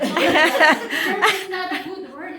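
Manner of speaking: slow, giggling correction; laughing through the quote. Retold or imitated. Retelling the employee's lesson to the supervisor, laughing slowly. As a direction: laugh as you tell him it's bad. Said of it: "He said".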